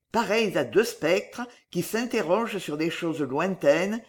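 usual speaking voice

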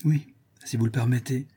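plain reading voice

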